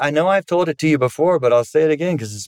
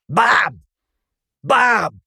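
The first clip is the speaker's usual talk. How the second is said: high, swooping scolding name-call; imitated belittling call; dismissive, harsh; 9 semitones higher than usual. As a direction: mimic a wife summoning him like a dog. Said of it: "is how you spoke to him".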